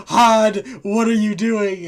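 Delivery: loud, high outraged shout; shocked yell. Retold or imitated. Gods shouting at Hod, loud shocked yell. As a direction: yell at him in disbelief. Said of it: "They're like".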